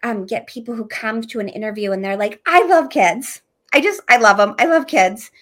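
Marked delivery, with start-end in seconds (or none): 2.4-5.3 eager. Retold or imitated. Eager interviewee quoted.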